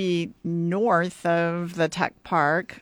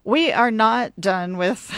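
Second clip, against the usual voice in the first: ordinary delivery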